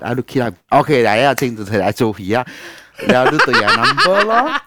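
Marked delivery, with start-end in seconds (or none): 3.1-4.6 talking through a laugh, higher and louder. Real, not acted. Recounting the paperwork steps to friends, laughing. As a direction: let laughter carry the words. Amused while telling the paperwork ordeal.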